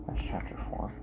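soft breathy whisper. Shown as a demonstration. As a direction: whisper the title to yourself. Whispered repeat of the title.

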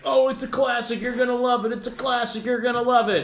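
high, sing-song mock enthusiasm; mocking hype. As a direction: parody people gushing over a classic. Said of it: "everyone's like".

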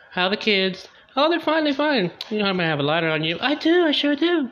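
chatty friends sharing a lighter; girly small talk. Retold or imitated. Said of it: "Dog's like".

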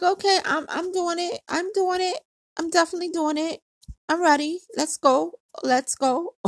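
high, gung-ho insistence; acted eager agreement. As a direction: reenact psyching yourself up, pitched high. Acting out her eager agreement.

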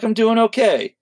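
"okay" lifted high with a falling end. Shown as a demonstration. Tom's second stock answer, said up high and falling. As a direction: say "I'm doing okay" up high, then let it drop. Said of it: "only two real answers".

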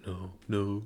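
low, very soft muttered refusal; imitated whispering. Host mimics friend backing out of a bet, hushed mutter. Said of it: "what you just whispering over?".

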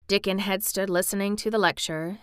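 usual speaking voice

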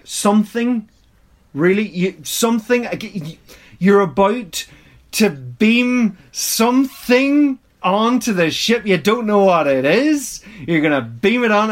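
rising pitch of incredulity at the screen; incredulous. Retold or imitated. Reacting to Scotty beaming something aboard, incredulous. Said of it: "And I'm like".

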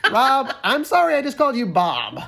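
normal talking voice

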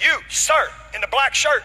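high, loud imitation of an agent; single someone out like a stern officer; mock-authoritative shout; authoritative call. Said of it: "I hear her get very authoritative".

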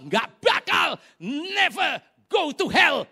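loud, high-pitched acted outburst; indignant mock tirade; angry refusal. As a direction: shout a furious refusal, drawing the words out. Acting an angry refusal to return.

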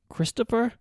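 mother hears her lost son, crying. A narrator's reading. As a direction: break down as you call his name. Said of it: "She was crying".

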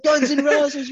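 very high, loud band-name yell; hyped-up fan shout. Retold or imitated. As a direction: holler the band name like a rally cry. Bandana fan hyping the crowd.

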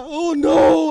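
high, loud imitated wail; mom's shocked disbelief. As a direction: cry out in mock shock. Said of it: "she goes".

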